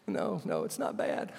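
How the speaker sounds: high, unvoiced, half-crying words; tearful reassurance. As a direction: choke out that it's fine. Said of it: "He's just crying like a baby".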